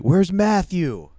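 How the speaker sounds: high, loud, slow call-out for Matthew; mock shout. Host expecting a caller's call-out, slow high shout. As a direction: bellow the name like a fan in the crowd. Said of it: "I thought he was gonna be like".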